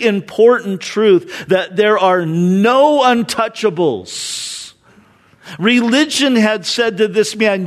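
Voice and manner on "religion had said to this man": normal talking voice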